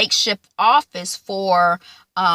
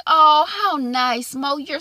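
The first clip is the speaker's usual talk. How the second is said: sugary fake; 7 semitones higher, slower than usual. Kibby's fake sweetness to Mo.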